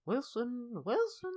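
very high-pitched character call; Jim from Friday Night Dinner. Acted. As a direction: squeak out the name in a bad impression. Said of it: "do a very good Jim from Friday Night".

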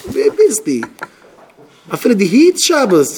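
high, loud mock confrontation; indignant challenge. Acted challenge in a quarrel. (אויפגערעגטע טענה)

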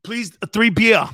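put-on loud, accented ordering voice; hammed-up counter order; imitated beer customer. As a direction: call out the order like a foreign customer. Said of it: "This guy goes".

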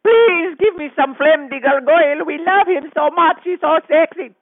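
high gushing fan impression; mock fan voice. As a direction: swoon like a smitten listener. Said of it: "Calling in and saying".

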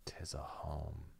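breathy whispered line, no voice. Shown as a demonstration. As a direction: whisper it softly. Whispered quote of riddle.

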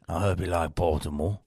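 lightly voiced director shooing him out; a hint of a gruff director; director dismissing. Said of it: "And he goes".

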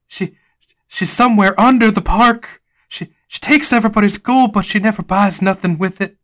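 high, loud frantic warning; frantic Jonas. Acted. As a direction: urge them in a rising panic. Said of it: "Jonas says".

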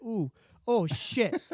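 soft, drawn-out, overcome; hushed mock tearfulness; mock choked up. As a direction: play at being moved to tears. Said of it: "choked me up a bit".

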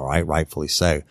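normal talking voice

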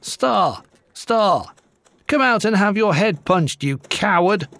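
narrator's restrained version of an angry challenge called from the gate; calling out. A narrator's reading. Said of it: "called out in a loud voice".

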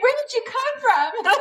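high, giggling wonder at him; laughing in disbelief. Retold or imitated. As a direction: laugh it out, amazed he exists. Quoting herself, overwhelmed by kindness.

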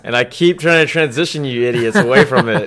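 loud, laughing mock exasperation; laughing complaint. Real, not acted. Teasing the co-hosts for returning to Redskins, laughing loudly.

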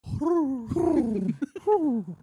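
high fluttering coo; bird cooing. Acted. Playing along with the bird, high cooing.

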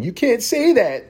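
high, slow whiny voice; mock offended. Vlogger mocking the ableist community, high and whiny. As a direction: whine it like an offended scold. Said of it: "community that's saying".

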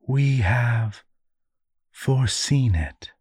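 mock-wise, portentous pronouncement; affected sagacious tone. A narrator's reading. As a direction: intone it like a wise seer. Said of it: "affected a sagacious tone".

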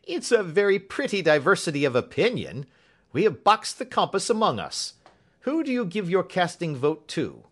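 high, loud, jovial inspector; amused exclaiming. A narrator's reading. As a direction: cry it out with laughter behind it. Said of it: "cried the inspector, laughing".

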